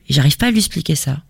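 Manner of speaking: usual speaking voice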